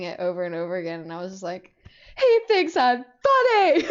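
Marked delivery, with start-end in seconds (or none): 2.2-3.8 high, loud, slow and drawn out; giddy fangirl squeal. Retold or imitated. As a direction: squeal with overjoyed laughter. Reenacting giddy fangirl reaction.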